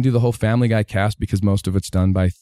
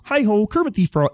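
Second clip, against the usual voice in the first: much higher frog puppet voice; Kermit the Frog; 12 semitones higher than usual. Shown as a demonstration. Voice actor showing his childhood mimicry, high frog impression. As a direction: put on a high-pitched puppet frog for the greeting. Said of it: "a line from Kermit the Frog".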